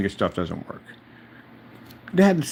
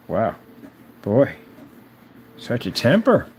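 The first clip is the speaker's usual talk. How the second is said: usual speaking voice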